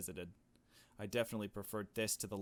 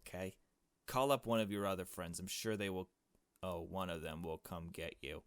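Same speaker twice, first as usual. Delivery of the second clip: ordinary talk, nothing marked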